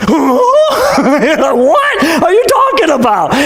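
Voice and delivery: stretched, very slow, high Jim Carrey-style what; rubber-faced disbelief. Retold or imitated. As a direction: mug the shock for laughs. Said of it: "this is where Haman goes".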